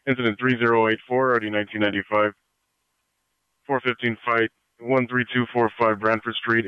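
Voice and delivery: steady dispatcher read over radio; crackly scanner broadcast; radio static bursts. Real, not acted. Police radio dispatch call.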